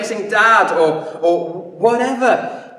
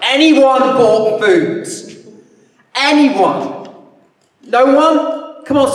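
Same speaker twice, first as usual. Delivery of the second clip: slow, loud call over a crowd, higher; 5 semitones higher, 6 dB louder, much slower than usual. Retold or imitated. Andrew calling loudly across the crowd for food. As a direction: shout to thousands asking for food.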